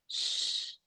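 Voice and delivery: imitando um "shh" sussurrado, sem voz; faça o chiado de quem pede silêncio; "shh" para acalmar. Retold or imitated. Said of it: "mandou ficar quieta". (chiado suave pedindo silêncio)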